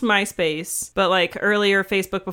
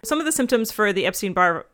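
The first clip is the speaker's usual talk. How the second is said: ordinary talk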